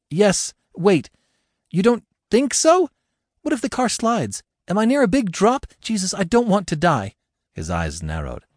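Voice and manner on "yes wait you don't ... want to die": high, frantic questioning; frightened questions. Acted. Frightened woman questioning rescuer.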